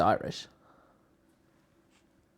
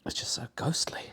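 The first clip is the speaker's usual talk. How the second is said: whispering; 10 dB quieter than usual. Real, not acted. Whispering about eerie film scene.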